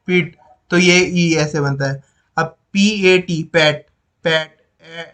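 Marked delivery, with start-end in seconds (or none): 3.4-4.6 "पैट" with a strong, drawn-out ै vowel; stretched vowel. Shown as a demonstration. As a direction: lean slowly on the vowel in "पैट". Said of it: "ज्यादा तेज आ रही है".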